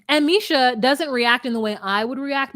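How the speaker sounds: ordinary delivery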